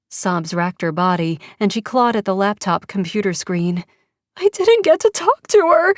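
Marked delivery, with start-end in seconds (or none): 4.4-5.9 high, loud tearful wail; a mother crying out her loss; much higher, louder tearful cry; anguished sob. Acted. Sobbing mother.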